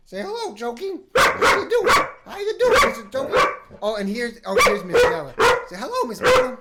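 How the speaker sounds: high-pitched pet talk; doggy talk. Real, not acted. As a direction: coo at the dogs excitedly. Greeting the dogs on camera.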